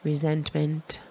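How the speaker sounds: slow meditation voice with long pauses; unhurried, calming guidance; guided meditation. Acted. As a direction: guide them gently into relaxation, phrase by phrase.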